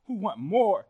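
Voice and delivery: ordinary delivery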